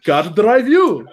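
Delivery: hammed-up Soviet Russian accent. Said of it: "I can do a Russian accent".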